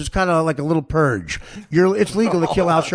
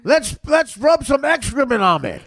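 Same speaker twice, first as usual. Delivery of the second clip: normal talking voice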